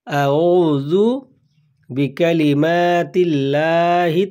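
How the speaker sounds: আউযু বি কালিমাতিল্লাহি তাম্মাতি drawn out slowly, word by word; slow Arabic recitation. Shown as a demonstration. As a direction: recite each word slowly so learners can repeat it. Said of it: "তো উচ্চারণটি এইরকম হবে". (ধীরে ধীরে ভেঙে ভেঙে তিলাওয়াত)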